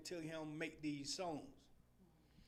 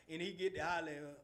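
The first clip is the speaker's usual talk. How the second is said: ordinary talk, nothing marked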